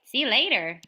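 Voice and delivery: ordinary talk, nothing marked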